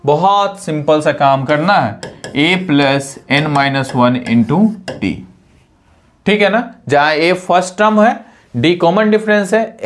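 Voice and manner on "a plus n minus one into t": slow dictation. Shown as a demonstration. Teacher dictates the formula.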